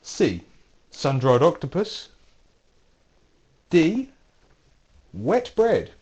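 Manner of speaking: hammed-up Greek accent on the last dishes; playful Greek accent listing silly lunches. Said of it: "Don't know why I have to do the accent".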